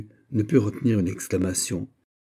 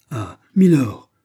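loud, higher, drawn-out exclamation; startled exclamation; 5 semitones higher, 9 dB louder, slower than usual. A narrator's reading. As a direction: cry out on recognizing a lord. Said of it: "s'écria Kaiser". (exclamation forte et étonnée)

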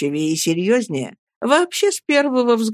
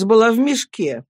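plain reading voice

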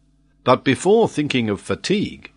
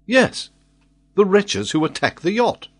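raised, emphatic answer; insistent lord; 10 semitones higher, 3 dB louder than usual. A narrator's reading. Glenarvan pressing the baffled old sailor, insistent. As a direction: press the point, pitched high.

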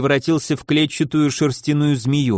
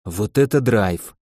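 grinning; 6 semitones lower than usual. A narrator's reading. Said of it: "не в силах скрыть радостной широченной улыбки".

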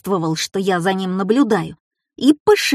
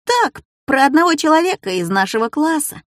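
higher-pitched, cagey reply; mysterious, teasing answer; 6 semitones higher than usual. A narrator's reading. Schoolboy answering the teacher about his essay, mildly mysterious. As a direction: keep it a secret, a touch mysterious. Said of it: "загадочно ответил я".

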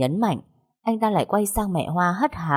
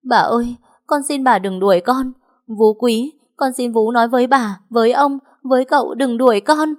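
loud, high-pitched plea; desperate begging; 7 semitones higher, 5 dB louder than usual. A narrator's reading. Maid implores mistress and nanny to keep her, desperately. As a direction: cry out imploringly, sustained high. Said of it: "Con Mai lại van xin".

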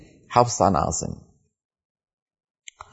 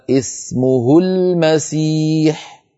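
slow recited phrase; slow tajweed reading; Quran recitation; 4 semitones higher, much slower than usual. Shown as a demonstration. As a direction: recite slowly. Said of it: "مثلًا".

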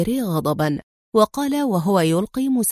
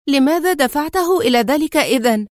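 raised, higher-pitched indignant question; angry exclamation; 6 semitones higher, 4 dB louder than usual. A narrator's reading. Woman challenges her partner for provoking the enemy, angrily. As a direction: demand an answer in acted anger. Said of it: "ثم هتفت في غضب".

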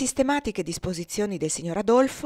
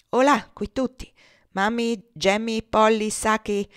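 raised, louder summons; calling out; 4 semitones higher, 5 dB louder, slower than usual. A narrator's reading. Master summons the household servants, calling out. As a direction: hail them all by name. Master calls his slaves together.

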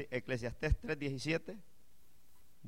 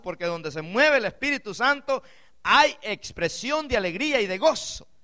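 louder, higher preaching build; rising fervent sermon cry; full-force preaching; 6 semitones higher, 10 dB louder than usual. Acted. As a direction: preach it out to the whole church.

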